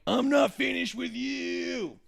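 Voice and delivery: slow, high menacing mock line; mock wrestler threat; wrestler catchphrase. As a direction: drawl it like a heel wrestler.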